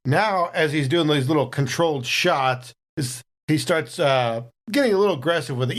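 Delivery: usual speaking voice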